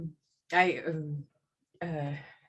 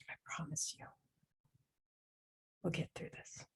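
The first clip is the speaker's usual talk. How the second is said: soft, steady whisper to calm someone; hushed comforting whisper; reassuring whisper; 10 semitones lower, 12 dB quieter than usual. Acted. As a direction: whisper them through the fear gently. Calming a frightened person in crisis.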